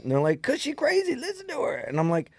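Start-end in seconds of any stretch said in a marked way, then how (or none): 0.4-1.8 put-on high, softer kid voice; mimicked kids. Kids justifying themselves to teacher, high mimicked child voice. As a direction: whine it up high like a defensive child.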